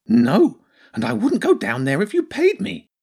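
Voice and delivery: high, emphatic creature voice; frightened hedgehog refusal. Acted. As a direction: refuse outright in a high, alarmed voice.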